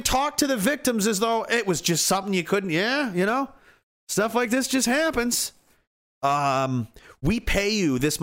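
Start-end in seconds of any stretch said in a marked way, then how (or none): 2.7-5.5 higher, sneering official brush-off; mock dismissive excuse.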